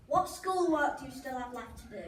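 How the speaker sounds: ordinary talk, nothing marked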